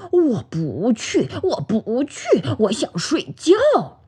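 slow, whiny sleepy refusal; cross little bear dragging out each refusal; mildly angry. A narrator's reading. Bear under the quilt refuses friends, slow sulky anger. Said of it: "生气地说".

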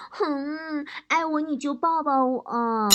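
slow, drawn-out wifely pleading; insistent, pestering. Acted. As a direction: play a wife who won't let it go. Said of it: "王嫂不依不饶". (不依不饶地拖长声音撒娇)

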